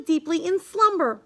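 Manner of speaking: plain reading voice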